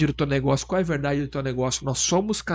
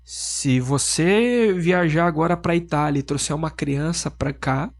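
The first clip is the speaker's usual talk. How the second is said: ordinary talk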